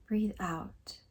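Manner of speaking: soft, calm meditation guide with long pauses; hushed, soothing relaxation voice; guided meditation. Acted. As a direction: gently lead them through breathing and reflection, leaving space.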